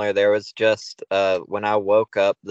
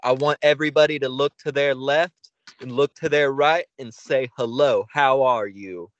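wrestling promo; 4 semitones higher than usual. Retold or imitated. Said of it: "cutting a promo".

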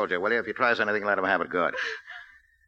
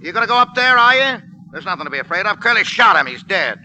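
louder, higher harsh push; angry bullying; 8 semitones higher, 8 dB louder than usual. Acted. Gangster bullies frightened woman to go up. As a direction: force her up the stairs.

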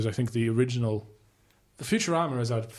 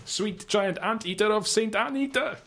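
put-on slow, much higher Jamaican accent; 10 semitones higher than usual. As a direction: drag out a terrible Jamaican accent. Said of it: "This is a terrible Jamaican accent".

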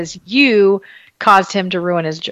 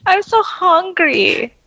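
much higher, slow squeaky whine; rubbery high squeaky toy; 10 semitones higher, slower than usual. Retold or imitated. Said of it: "And then he goes".

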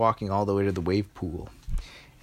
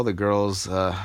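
ordinary delivery

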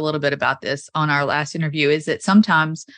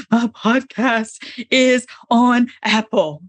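mock wail; 4 semitones higher, much slower than usual. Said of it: "I was like".

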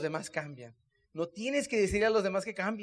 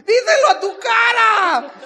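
very high, loud, slow yell; hammed-up furious comeback at full voice; exasperated shout; 11 semitones higher, 12 dB louder, slower than usual. Husband telling wife to show it, exasperated shout.

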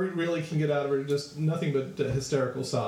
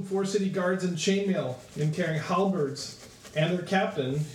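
usual speaking voice

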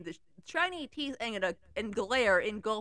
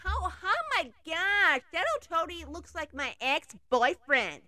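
snapping; 6 semitones higher than usual. Acted. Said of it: "snapped Theodoti".

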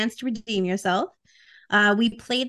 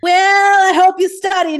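teasing; 8 semitones higher, 9 dB louder than usual. Pretend. Teasing quiz start, loud.

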